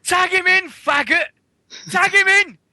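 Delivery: high, loud crowd yell; imitated heckler's shout. Imitating someone shouting at Miz, high loud heckle. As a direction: holler it like an impatient wrestling fan. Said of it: "Somebody actually shouted at Miz".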